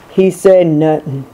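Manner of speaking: ordinary delivery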